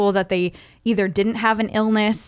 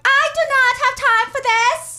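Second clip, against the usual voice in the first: high, haughty put-on Anna accent, much higher and louder; Anna's accent; 4 dB louder than usual. Mocking Anna's accent to her cohost, a high haughty accent. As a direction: sniff it out like a fake European heiress. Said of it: "can we do a whole show where you talk like, uh, Anna?".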